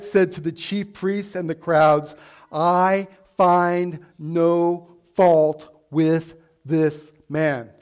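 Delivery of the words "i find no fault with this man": very slow, word by word; slow, measured pronouncement with pauses; solemn verdict. Retold or imitated. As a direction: deliver each word separately, weightily. Pilate's verdict read out deliberately.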